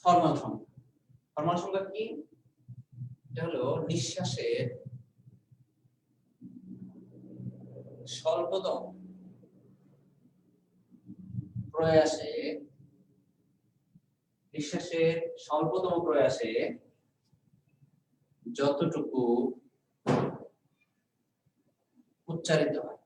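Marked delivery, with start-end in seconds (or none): 3.9-12.3 word by word with long pauses; measured classroom dictation; dictating. Real, not acted. Dictating a definition to students. 14.5-23.0 phrase by phrase with long pauses; halting classroom dictation; dictating. Real, not acted. Dictating a definition to students.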